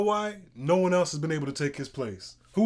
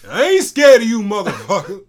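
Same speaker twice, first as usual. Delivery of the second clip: fake tough guy swagger; 4 semitones higher, 9 dB louder than usual. Quoting Bernie Mac bit.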